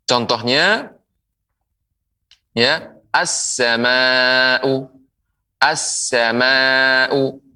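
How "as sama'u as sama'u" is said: "as-sama'u" very slowly with doubled s; slow model of "as-sama'u", hissed s held long; lam merged into sin. Shown as a demonstration. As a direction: draw out "as-sama'u", long second a, no l. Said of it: "lamnya dilebur menjadi".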